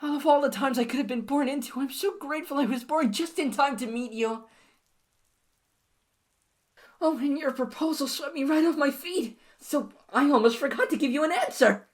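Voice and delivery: put-on overjoyed girlfriend answering a marriage proposal; ecstatic. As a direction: squeal with delight at the ring.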